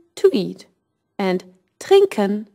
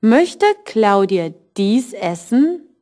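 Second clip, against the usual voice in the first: slowed German question for learners; slow, careful teacher question; slow model question; slower than usual. Shown as a demonstration. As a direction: read it slowly for the class. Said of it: "And for questions".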